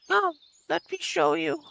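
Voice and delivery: put-on high, slow old lady voice. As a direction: beckon like a frail old woman. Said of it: "she said quietly".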